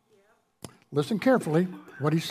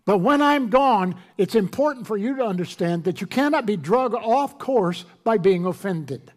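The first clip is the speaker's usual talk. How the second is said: preaching; 2 semitones higher, 4 dB louder than usual. Acted. Preaching Jesus' words.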